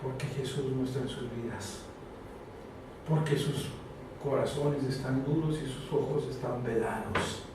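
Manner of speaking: hushed, earnest pulpit talk; intimate sermon. Acted. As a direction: lean in and confide it to the congregation. Intimate low preaching passage.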